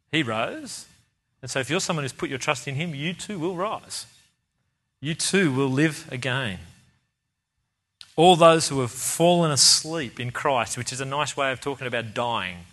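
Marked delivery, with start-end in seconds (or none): none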